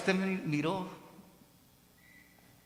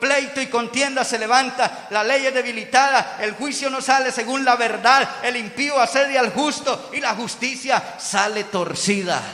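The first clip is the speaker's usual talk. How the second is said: raised, forceful litany of grievances; crying out to God; 6 semitones higher, 6 dB louder than usual. Acted. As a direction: pile up the complaints insistently. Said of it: "clamando y diciendo".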